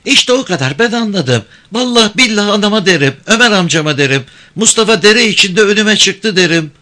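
put-on high-pitched girl threatening to tell; high young voice, pert and unyielding; defiant village girl. A narrator's reading. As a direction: threaten him in a girl's high, insistent voice.

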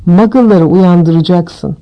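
plain reading voice

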